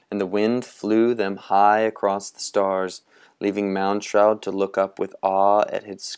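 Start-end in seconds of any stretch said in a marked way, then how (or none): none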